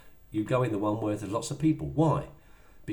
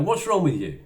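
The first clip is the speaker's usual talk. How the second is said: higher, louder accusing snap; 7 semitones higher, 6 dB louder than usual. Retold or imitated. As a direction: challenge the partner sharply. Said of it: "and you go".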